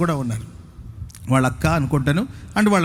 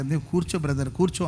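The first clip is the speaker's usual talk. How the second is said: soft, settling a brother down; quiet and hushed, easing him back to his seat; gentle calming; 4 semitones lower, 8 dB quieter than usual. Retold or imitated. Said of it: "అంటుంటే".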